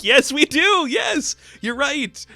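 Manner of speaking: high squealing agreement; excited. Real, not acted. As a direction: squeal yes with delight.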